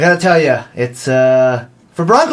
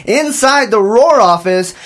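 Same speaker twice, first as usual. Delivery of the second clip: bright, higher announcer voice opening the show; radio host; 5 semitones higher than usual. Acted. Host greeting the audience on campus radio, upbeat announcer read. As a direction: welcome the listeners like a live radio host.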